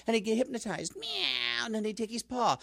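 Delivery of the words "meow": high cat meow. Acted. Cat luring mouse.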